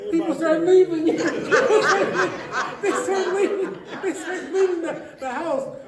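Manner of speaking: words through real laughter; can't stop laughing mid-story; high breathless laughing retelling; laughing while telling. Real, not acted. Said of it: "I'm laughing because".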